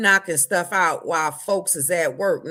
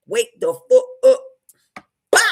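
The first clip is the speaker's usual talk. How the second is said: loud wake-up call; 3 semitones higher, 9 dB louder than usual. Real, not acted. Rousing sleepy viewers.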